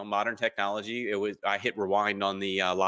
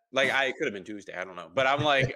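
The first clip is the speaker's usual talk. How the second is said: ordinary talk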